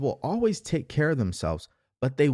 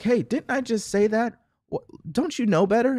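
higher, exasperated parent voice, hammed up; mock scolding parent; 9 semitones higher than usual. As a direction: play the nagging parent scolding a kid. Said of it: "they're like".

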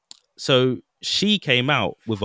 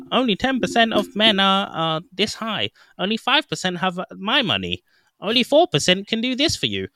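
mocking a super nerdy braggart, pitched higher; nerdy guy voice; 9 semitones higher than usual. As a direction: imitate a bragging nerd's dating profile, high and smug. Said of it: "I don't know why I'm doing this voice, but that's how he sounds, like, super freaking nerdy".

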